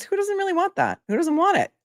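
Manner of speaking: high playful teasing voice; teasing singsong. Acted. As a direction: tease them, sky-high and coy. Teasing viewers about merch.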